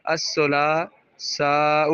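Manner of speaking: الثلاثاء drawn out slowly, each syllable given its full weight; slow model pronunciation. Shown as a demonstration. Teacher modelling the Arabic word الثلاثاء, slow and careful. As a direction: stretch out الثلاثاء carefully for the class to copy. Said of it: "ثلاثتن سے کیا بنا؟".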